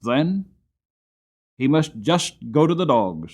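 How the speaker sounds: a hint of a callous master, dismissive; Skinner. A narrator's reading. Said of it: "said Skinner".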